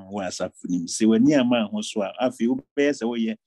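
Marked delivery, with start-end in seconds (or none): none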